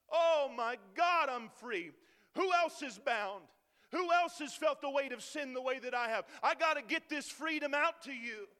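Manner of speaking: loud, ringing cry, slowing on the repeated Oh my God; joyful cry of freedom. Retold or imitated. Newly saved believer crying out his freedom, loud and ecstatic. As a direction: shout it out like a man just set free. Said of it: "there's a desire in you to say".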